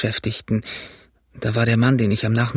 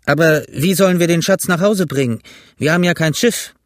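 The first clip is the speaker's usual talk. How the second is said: loud, higher-pitched objection; challenging mutineer; 5 semitones higher, 5 dB louder than usual. A narrator's reading. George asks how to get treasure home, challenging. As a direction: challenge the others in a raised voice.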